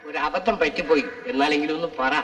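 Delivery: low, soft climb-down; sheepish apology. Acted. Questioner admitting a slip after being rebuked, sheepish. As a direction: back off quietly, voice dropped.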